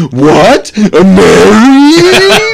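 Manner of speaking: stretched, high, loud shocked wail; shocked disbelief. Retold or imitated. Jack hearing about the marriage, stretched shocked cry. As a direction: Jack reeling at the marriage news. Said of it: "Jack's like".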